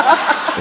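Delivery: a short burst of laughter at the joke; real laugh; high laugh. Real, not acted. Laughing at toilet sign joke.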